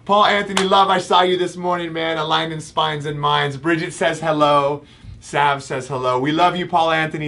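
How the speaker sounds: high-pitched, excited shout-out; excited greeting. Real, not acted. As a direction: greet a friend in a high, delighted voice. Excited greeting to a known viewer.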